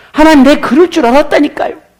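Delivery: a prophet who saw this coming, fed up; Jonah's exasperation. Retold or imitated. 요나가 하나님께 따지며, 높고 큰 소리로 언짢게. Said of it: "요나는 마음에 있는 이 분노를 쉽게 잠재울 수가 없습니다".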